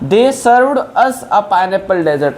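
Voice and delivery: reads the English example very slowly; slow dictation. Shown as a demonstration. Teacher to Hindi-speaking learners, slow dictation pace. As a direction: give every word its own space.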